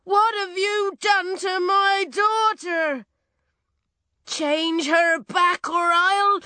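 high, loud, outraged threat; furious yelling. A narrator's reading. Farmer to the witch about his daughter, furious yelling. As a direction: demand it at full voice. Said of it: "he yelled".

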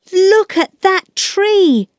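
put-on high-pitched, drawn-out boy's cry of alarm; high storybook shout; excited shout. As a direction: call it out high and slow, pointing out danger. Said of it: "Peter shouted".

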